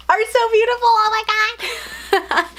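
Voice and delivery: high, slow delighted squeal; shrieking jackpot winner. Real, not acted. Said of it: "Sorry if I sound like a little kid".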